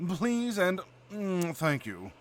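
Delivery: nasal, drawn-out British bluster; very posh British, humming into the words. Shown as a demonstration. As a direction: bluster through it like a snooty Brit. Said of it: "a little bluster".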